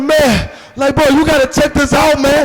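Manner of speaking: eager shout to a stranger walking past; excited call-out. Retold or imitated. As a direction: call him over, all hyped up. Calls out to passer-by.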